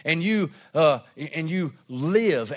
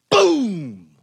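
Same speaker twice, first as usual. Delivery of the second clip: one booming word, falling steeply away; punchy exclamation; 6 dB louder than usual. Real, not acted. Preacher capping a verse for the congregation, punchy exclamation. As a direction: drop it like a bomb after the verse. Punctuates the verse just read.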